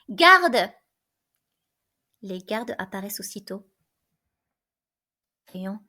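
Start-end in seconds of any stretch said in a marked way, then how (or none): 0.1-0.7 loud sudden call for the guard; sudden shout. A narrator's reading. Creon suddenly shouts for the guard. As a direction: bark one word for the guard. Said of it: "Créon crie soudain".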